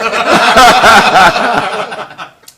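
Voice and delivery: loud, high belly laugh; big laugh. Real, not acted. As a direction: burst out laughing.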